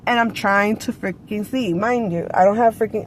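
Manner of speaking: normal talking voice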